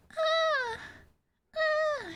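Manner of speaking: very high little squeaky moan; imitated turtle moan. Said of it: "the turtle having sex noise".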